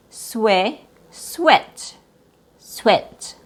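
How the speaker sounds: SWEAT with a short, lax vowel; relaxed short vowel. Shown as a demonstration. Teacher repeating the right version for learners, relaxed vowel. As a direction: model "sweat" without tensing the vowel. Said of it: "It's more relaxed".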